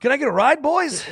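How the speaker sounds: high, loud, hopeful call; hammed-up high-pitched request; mock friendly pleading. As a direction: imitate a pal begging his way back in. Dango sheepishly begging for a ride.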